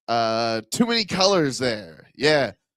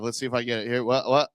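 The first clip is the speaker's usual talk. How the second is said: normal talking voice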